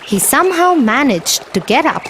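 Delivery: plain reading voice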